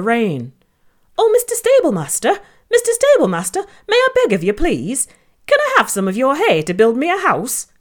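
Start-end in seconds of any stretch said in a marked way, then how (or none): 1.2-7.7 squeaky little pig, pleading; high piglet voice. Acted. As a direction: beg sweetly in a high piggy voice. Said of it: "asked Larry".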